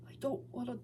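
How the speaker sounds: soft, tearful plea; with a cry. A narrator's reading. Broken Sonic crying to Hog, softly and tearfully. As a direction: beg through tears, barely holding on. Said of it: "He would say with a cry".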